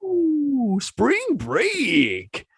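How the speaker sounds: high, drawn-out whoop; party whoop. A narrator's reading. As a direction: whoop it up like a spring breaker. Party whoop repeated in the retelling.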